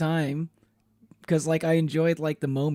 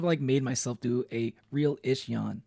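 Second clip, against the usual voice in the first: usual speaking voice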